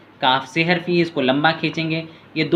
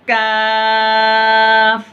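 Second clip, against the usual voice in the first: slow, high, louder chanted lam alif; drawn-out recitation; 6 semitones higher, 5 dB louder, much slower than usual. Shown as a demonstration. Teacher demonstrates the lesson's letters to pupils, slow chanted and louder. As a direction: hold लाम very long, then a falling अलिफ. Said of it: "देखिए ऐसे पढ़ना है".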